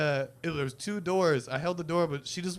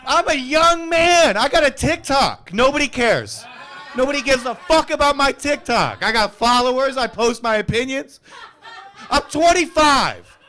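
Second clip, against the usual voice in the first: mock young TikToker; 10 semitones higher, 12 dB louder than usual. Comic mocking a young heckler, high loud whiny voice. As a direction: parody the self-important young guy.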